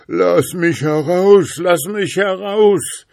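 very high, loud, drawn-out plea; shrieky little captive calling to get out; trapped creature's cry. A narrator's reading. Froglike thing jumping in the bottle cries for freedom, high and loud. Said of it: "rief's von neuem".

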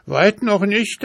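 very high horse voice, urgent; horse's voice. A narrator's reading. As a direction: answer high and pressing as the alarmed horse. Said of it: "antwortete der Schimmel".

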